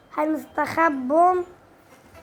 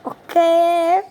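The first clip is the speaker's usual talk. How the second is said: normal talking voice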